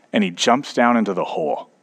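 usual speaking voice

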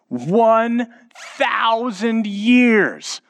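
very high, drawn-out proclamation; dramatic reveal. Retold or imitated. Father revealing Satan's sentence to his son, drawn-out drama. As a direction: stretch it out grandly for a wide-eyed child.

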